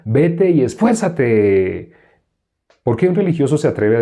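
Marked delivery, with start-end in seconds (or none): 0.0-1.9 very slow, stretched-out urging; mock religious admonishing. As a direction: parody a pious demand for effort. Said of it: "Un religioso le diría".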